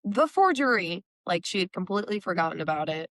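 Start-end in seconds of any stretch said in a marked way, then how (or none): 0.0-1.0 higher-pitched retold outcry; mock call-out, pitch jumping up. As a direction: blurt it out in a raised voice. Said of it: "calls out".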